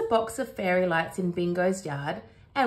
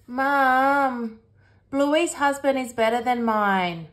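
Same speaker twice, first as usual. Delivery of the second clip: drawn-out, higher, louder whine to Mum; complaining child; 5 semitones higher, 5 dB louder, slower than usual. A narrator's reading. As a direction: complain to your mother like a put-out kid. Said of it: "complains Bluey".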